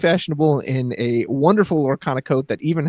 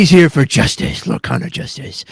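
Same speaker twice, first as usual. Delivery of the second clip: Batman; 5 dB louder than usual. Acted. Caster introducing player Justice, growled superhero voice. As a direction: growl it out like a masked vigilante. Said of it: "say it in the Batman voice".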